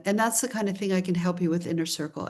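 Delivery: ordinary talk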